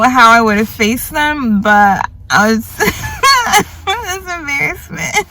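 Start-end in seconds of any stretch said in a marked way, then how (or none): 2.8-5.3 high laughing words; words slowed by laughter; embarrassed laugh. Real, not acted.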